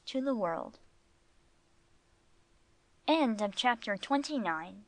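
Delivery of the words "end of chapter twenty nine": usual speaking voice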